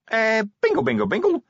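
tiny, very high, nervous imp; small nervous voice. A narrator's reading. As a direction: squeak it out like a jittery little gadget. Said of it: "a small and very nervous voice".

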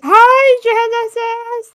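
high bright yelled greeting, stretched out; excited yell. Acted. [name] yelling hi into Billy's phone, loud and high. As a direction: shout the name across the room. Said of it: "[name] just yells".

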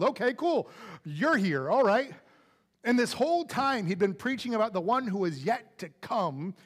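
ordinary talk, nothing marked

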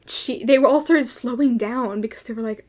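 ordinary talk, nothing marked

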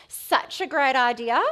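loud, drawn-out mock approval; mock-amused scepticism. Busy listener scoffing at someone suggesting Sabbath, mock-amused scepticism. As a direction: stretch it slowly as if humouring the idea. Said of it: "maybe you're having that silent chuckle".

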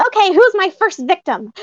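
high put-on puppet voice, a bit clumsy; bad Prairie Dawn. Fan imitating the puppet for her friend, high and hammy. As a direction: squeak it out like a shy puppet. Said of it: "I have a bad Prairie Dawn".

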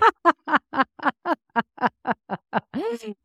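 high, bubbling laugh that breaks out; real burst of laughter. Real, not acted. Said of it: "I'm only laughing because".

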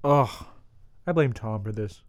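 usual speaking voice

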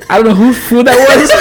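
can barely get the words out through laughter; laughing. Real, not acted. Laughing through own story.

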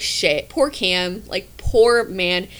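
slow, drawn-out mock pity; mock sympathy, slowly. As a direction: pity him, dragging the words. Pitying fiance, mock.

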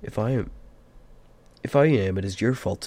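angry. A narrator's reading. Bilbo blaming Gandalf for his temper, angry. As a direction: throw the blame back crossly. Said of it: "no need to get angry".

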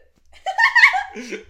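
high squealing burst of laughter; loud, high laughing squeal; shrieking laugh. Real, not acted. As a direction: crack up in a shriek. Laughs at friend's photo.

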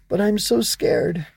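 high, slow frightened confession; scared child. Acted. Ava confesses to the Growbug, scared. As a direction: admit fear in a little girl's voice.

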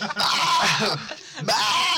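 loud, high strained cry; failed scream. Retold or imitated. Sick kid being tickled without mercy, failed scream. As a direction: try to scream with no voice left. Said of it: "just couldn't even scream".

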